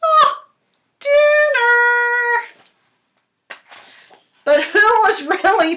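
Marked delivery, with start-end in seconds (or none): 0.0-2.5 high, loud gleeful dinner; playful hungry thought; mock eager thought. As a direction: savor the word like a hungry goose. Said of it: "what we were probably thinking was".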